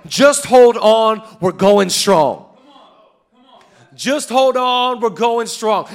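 rally the room with it; loud slow refrain. Acted. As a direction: hold on, belted slowly. Chanted refrain, full force.

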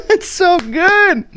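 high, slowed, still laughing; laughing delight. Real, not acted. Raving about the movie, still laughing. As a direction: gush through leftover laughter.

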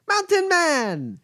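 high, drawn-out call across the bar; a boy hollering a nickname from afar; excited kid shouting a greeting. Acted.

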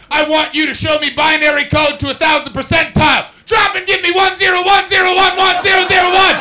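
high, loud drill sergeant bark; barking gym coach. Pretend. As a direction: shout it like a boot camp drill instructor. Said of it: "just like".

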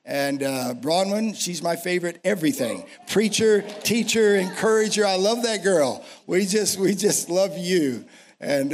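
ordinary delivery

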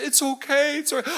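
high mock-crying voice; tearful reassurance. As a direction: imitate a woman crying as she forgives. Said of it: "she starts crying".

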